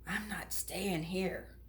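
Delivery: slow, hushed, scared whisper; frightened whisper. Acted. Punchinello, scared in the huge workshop, whispering. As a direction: whisper it like you want to run.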